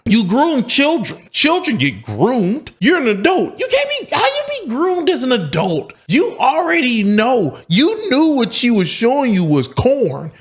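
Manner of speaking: ranting herself into outrage; getting worked up. Real, not acted. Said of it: "before I got triggered".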